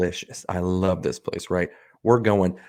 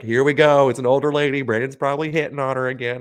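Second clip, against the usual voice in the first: teasing coworkers; 6 semitones higher, 5 dB louder than usual. Retold or imitated.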